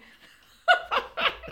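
high loud laughing burst; burst of laughter. Retold or imitated. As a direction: laugh out in shock. Told she leads the race.

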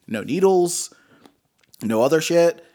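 ordinary delivery

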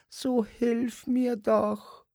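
very high and slow, a thin pleading squeak; trembling little voice. A narrator's reading. Puppet dwarf begs Simon at night, very high and slow. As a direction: drag out the plea like a frightened little dwarf. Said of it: "zittriges Stimmchen".

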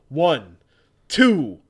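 booming referee count; loud count. Acted. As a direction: call each number out to the crowd.

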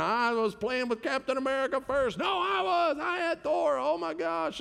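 high-pitched mock kid outburst; squabbling little boys. Dad imitating his boys fighting over Avenger toys, high squabbling. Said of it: "the boys were fighting about their Avenger toys".

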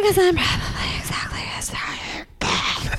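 whispered confession. Real, not acted. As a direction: hush it like a secret. Confessing crush in an aside.

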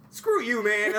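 a hammed-up inner grumble at the barista; mock angry thought. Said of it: "In my head, I'm like".

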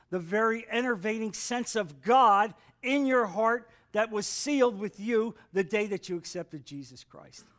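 ordinary delivery

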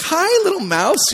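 slow high coo to the mouse; cooing greeting. Retold or imitated. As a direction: greet a tiny mouse sweetly. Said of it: "and I'm like".

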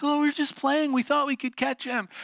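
lightly imitated sisters, pitched high; sisters' excuse. Sisters explaining themselves to their dad, lightly voiced high excuse. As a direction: make excuses in a high kid voice.

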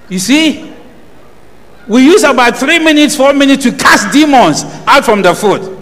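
loud, high, fervent pulpit voice; full-force preaching. Acted. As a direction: drive the point home to the congregation.

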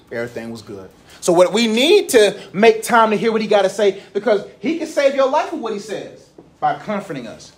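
normal talking voice

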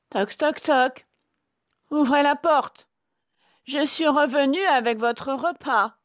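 high, loud falsetto disguise; sweetened wolf voice. Acted. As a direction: coo like a wolf posing as mother. (fausse voix douce de loup, aiguë)

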